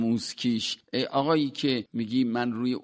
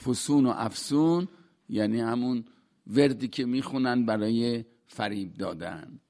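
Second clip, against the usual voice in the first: normal talking voice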